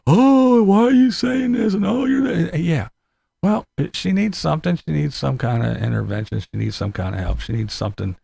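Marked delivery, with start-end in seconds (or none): 0.1-2.2 very high whiny mock voice; mocking critics. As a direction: whine like an offended fan. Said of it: "everybody's jumping".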